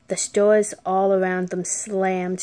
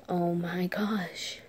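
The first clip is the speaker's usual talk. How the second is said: scared whisper; 2 semitones lower, 8 dB quieter, slower than usual. Retold or imitated. Frozen mother sees shadow.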